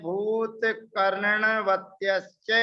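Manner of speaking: slow, deliberate "भूत कर्णवत्यश्च" with every syllable clear; careful recitation. Shown as a demonstration. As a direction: recite "कर्णवत्यश्च" slowly and distinctly. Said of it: "उच्चारण मेरा देखना". (धीमा, स्पष्ट सूत्र-उच्चारण)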